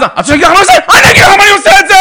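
high, loud yell; retold angry shout. Son blowing up at his mother, shouting. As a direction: blow up at her.

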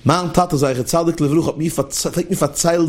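normal talking voice

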